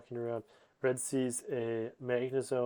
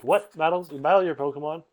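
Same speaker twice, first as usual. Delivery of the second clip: high, loud incredulous questions; mock astonishment; 4 semitones higher, 9 dB louder than usual. Club members react to hearing of Pokemon battles, astonished. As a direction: blurt it out in retold disbelief.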